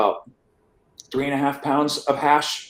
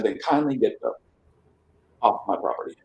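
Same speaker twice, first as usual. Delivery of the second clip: curt dismissal; 2 semitones lower, 7 dB quieter than usual. Retold or imitated. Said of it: "not really very kindly".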